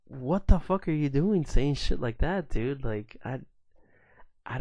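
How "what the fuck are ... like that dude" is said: high and loud, fed up; loud, high outrage at the customer; outraged. Real, not acted. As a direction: demand an answer, furious. Retelling outrage at racist comment.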